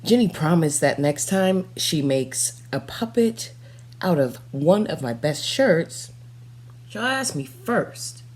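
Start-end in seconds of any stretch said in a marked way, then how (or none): none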